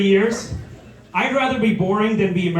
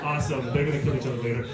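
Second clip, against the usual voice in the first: usual speaking voice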